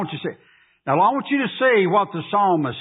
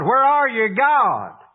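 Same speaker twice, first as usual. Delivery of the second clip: crying out; 5 semitones higher, 3 dB louder, slower than usual. Acted. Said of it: "He cried out to God".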